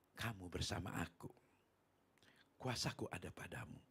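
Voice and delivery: low, hushed divine answer; God's voice. Retold or imitated. God reassures the frightened preacher, hushed low voice. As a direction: answer softly and low, fading into a whisper. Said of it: "saya berdialog dengan Tuhan".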